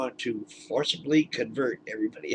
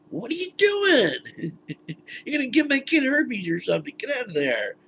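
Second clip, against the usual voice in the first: mock parent yelling; 11 semitones higher, 4 dB louder than usual. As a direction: yell at him to stop, hammed up.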